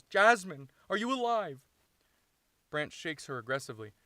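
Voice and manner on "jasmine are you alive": high, very loud, frantic call; scream. Acted. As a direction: scream to rouse an injured friend. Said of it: "He screams".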